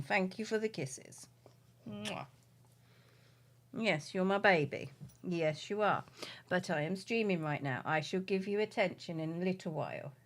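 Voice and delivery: soft, indulgent murmur to a dog; tender pet talk. Real, not acted. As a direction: speak quietly and fondly to your dog. Talking tenderly to her dog.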